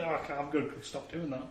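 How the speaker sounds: usual speaking voice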